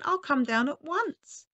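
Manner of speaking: high, eager call; high, rising promise; cried out. A narrator's reading. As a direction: call out eagerly, ready to help. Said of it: "cried the old woman".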